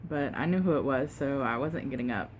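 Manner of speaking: usual speaking voice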